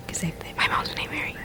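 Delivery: hushed whisper. Real, not acted. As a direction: whisper it aside. Sudden whispered aside.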